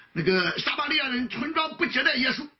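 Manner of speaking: normal talking voice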